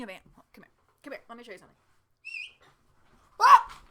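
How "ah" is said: sudden real shriek from falling; a sharp startled scream, very loud and high. Real, not acted. Falling off her chair mid-video, startled real scream. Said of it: "I just fell out of my chair".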